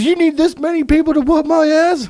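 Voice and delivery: high, loud mocking drunk voice; drunk taunt. The drunk guy taunting the crowd of sixty, high and mocking. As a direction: sneer it like a belligerent drunk.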